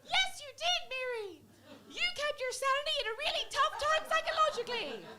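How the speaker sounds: very high, squeaky cheerleading puppet; sock puppet voice. Acted. Sock puppet praising its owner's sanity, high squeaky voice. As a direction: squeak loud encouragement in a tiny put-on voice. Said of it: "sock puppet".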